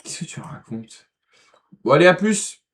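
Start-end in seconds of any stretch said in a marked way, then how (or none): 0.0-1.1 slow unvoiced whisper. Real, not acted. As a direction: whisper back, puzzled. Reply to odd remark. (chuchoté, perplexe)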